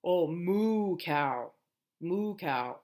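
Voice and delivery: drawn-out singsong moo cow; slow playful modeling. Shown as a demonstration. Therapist playing animal sounds with a child, drawn out. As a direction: linger on moo. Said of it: "What does a cow say?".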